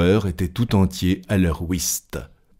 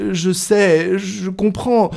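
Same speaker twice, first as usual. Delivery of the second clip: high-pitched, trying to get a word in; higher voice, placating and cut short; interrupted man insisting he follows; flustered Passepartout; 12 semitones higher, slower than usual. A narrator's reading. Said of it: "répétait Passepartout, auquel personne ne laissait achever".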